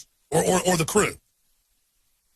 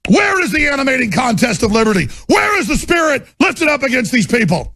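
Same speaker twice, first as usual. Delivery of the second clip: furious shout; 9 semitones higher, 7 dB louder than usual. Real, not acted. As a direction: bellow it like a preacher possessed by rage. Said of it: "I don't get like that a lot".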